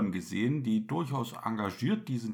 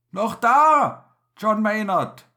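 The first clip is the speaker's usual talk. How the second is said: loud, high call through the tube; shouted call; 8 semitones higher, 9 dB louder than usual. Acted. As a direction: call out urgently. Captain calling again.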